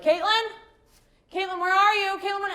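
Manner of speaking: put-on high child voice calling her name; whiny kids calling. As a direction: call out like a needy little kid. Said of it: "the kids are like".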